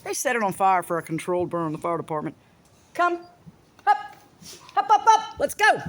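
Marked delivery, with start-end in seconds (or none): 2.9-5.8 high, coaxing call to hurry them out. Real, not acted. Calling pets outside.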